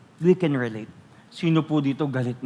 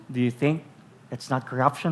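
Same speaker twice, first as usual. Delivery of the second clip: ordinary delivery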